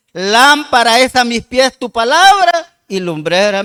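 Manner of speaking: loud, slow, drawn-out verse reading; stretched, ringing Bible quotation; scripture proclamation. Acted. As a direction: declaim the psalm to the whole congregation. Proclaims a quoted psalm verse.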